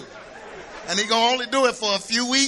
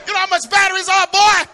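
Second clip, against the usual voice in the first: high, loud, fast exasperated yell; rapid shouted rebuke at a kid; scolding parent; 10 semitones higher, 8 dB louder, faster than usual. Retold or imitated. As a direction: bark it like a fed-up dad.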